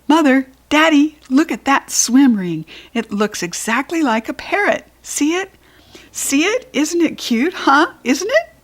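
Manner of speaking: put-on high, eager little-girl gushing; excited child pleading for a toy; gushy. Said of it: "heard me being gushy".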